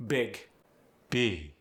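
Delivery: usual speaking voice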